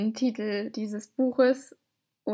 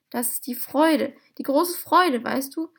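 acted joy, lifting higher and louder; overjoyed; 4 semitones higher, 7 dB louder than usual. A narrator's reading. Little man moved by his visitor, gushing with joy. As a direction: gush with storybook delight.

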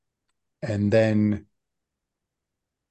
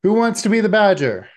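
much higher, loud, fast cry to the room; loud call; 12 semitones higher, 8 dB louder, faster than usual. Acted. Host shouts to the children, who wants to play, loud and high. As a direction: shout the question gleefully.

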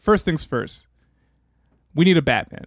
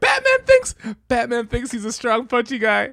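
mocking tone; 8 semitones higher than usual. Mocking Batman's claim.

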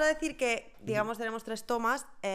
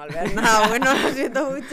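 loud laughing apology; 12 dB louder than usual. Real, not acted. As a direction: laugh through the words. Teasing joke, laughing loudly.

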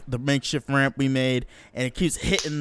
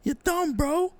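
put-on amazed kid; slower than usual. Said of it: "And I was like".

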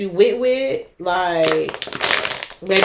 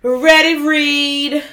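announcer; 6 semitones higher, 7 dB louder, much slower than usual. Acted. Announcing the segment.

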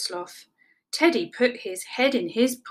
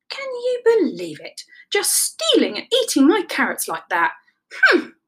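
high-pitched indignant grumble; complain, ending in a loud humph; affronted, huffing rabbit; huffy; 8 semitones higher, 4 dB louder than usual. A narrator's reading. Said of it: "huffed Rabbit".

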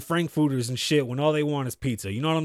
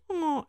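mock high moan; 11 semitones higher, 4 dB quieter than usual. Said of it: "And then Ling Ling goes".

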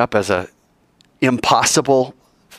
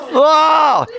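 high, loud startled "oh"; acted burst of alarm; shocked cry; 5 dB louder than usual. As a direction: recoil aloud in mock horror. Acting shock at the charging man.

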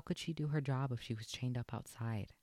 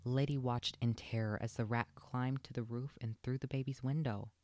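usual speaking voice

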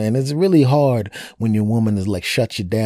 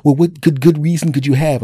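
high, louder indignant challenge; indignant girlfriend; 7 semitones higher, 6 dB louder than usual. Retold or imitated. Girlfriend challenging him, high and indignant. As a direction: demand it like an offended girlfriend. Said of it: "And she goes".

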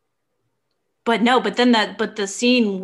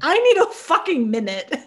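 higher, exasperated mock outburst; overwhelmed Morgaine; 7 semitones higher than usual. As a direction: voice her needing a break from it all. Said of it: "She's like".

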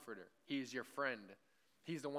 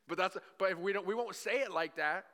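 louder, higher, driving the point home hard; harsh preaching; 8 semitones higher, 8 dB louder than usual. Real, not acted. Preacher turning harsh so his listeners feel it, loud. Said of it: "I'm trying to make it harsh for you guys".

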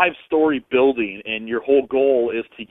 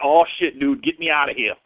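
mild mock panic, a bit louder; imitating freaked-out thugs; 3 semitones higher, 3 dB louder than usual. Caller imitating thugs in a haunted house, mildly freaked out. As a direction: play a tough guy lightly rattled. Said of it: "these guys will start freaking out".

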